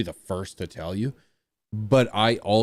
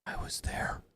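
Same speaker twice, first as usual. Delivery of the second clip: whisper; 14 dB quieter than usual. Pretend. Repeats imagined fan line.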